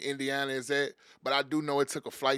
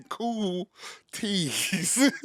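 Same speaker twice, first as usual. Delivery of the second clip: laughing; 3 semitones higher than usual. Shown as a demonstration. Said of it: "It sound like I'm saying cooties".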